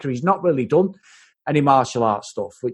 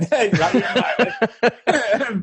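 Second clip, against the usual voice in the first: slow, higher Yorkshire caller, laughing through it; bad Yorkshire voice; 7 semitones higher, much slower than usual. S1 mimics the Yorkshire caller on the phone, laughing, in a hammed-up Yorkshire accent. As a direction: stretch it out in a mock Yorkshire accent. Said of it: "He sounds like from Yorkshire".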